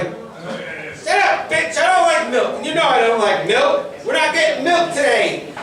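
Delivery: high, snotty little brat; bratty kid voice. Retold or imitated. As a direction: sneer it like a spoiled five-year-old. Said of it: "He's like".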